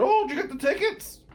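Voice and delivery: high rising clueless ask; mock clueless question. Ed expecting him to have paid, high and clueless. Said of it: "So I get there".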